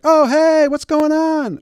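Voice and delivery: very high, loud chirpy greeting; cheerful crew greeting. Retold or imitated. Crew greeting the pilot, chirpy and high. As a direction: chirp it like friendly cabin crew. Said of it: "They're gonna be like".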